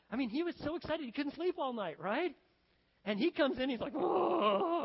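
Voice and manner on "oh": loud, high drawn-out wail; mock wailing moan. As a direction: wail "oh" in hammed-up grief. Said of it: "crying and weeping and mourning and moaning".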